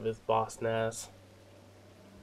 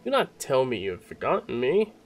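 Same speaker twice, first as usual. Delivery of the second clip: higher, declamatory royal recognition; hammed-up king, pitched up; grand theatrical king; 8 semitones higher, 6 dB louder than usual. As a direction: proclaim it like an astonished monarch. Voices the king's dialogue.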